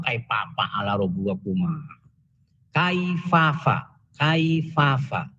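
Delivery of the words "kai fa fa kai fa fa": kai fa fa, slow, every fa a clear f; slow letter drill. Shown as a demonstration. Teacher drilling kai fa fa slowly for the class. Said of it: "Fa, jangan pa".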